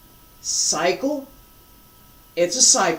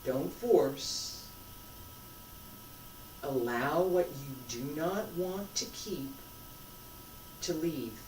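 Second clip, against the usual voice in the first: guided meditation; 17 dB quieter than usual. Acted. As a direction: ease them into each breath, softly. Leads a guided breathing exercise.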